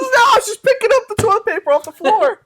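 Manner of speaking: high, loud innocent excuse; high mock excuse voice. Dog defendant caught sniffing pleads innocence, high and loud.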